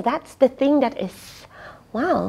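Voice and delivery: plain reading voice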